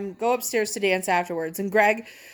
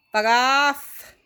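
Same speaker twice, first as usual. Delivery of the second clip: blunt telling-off; 4 semitones higher, 5 dB louder than usual. Retold or imitated. Tall friend tells guy off.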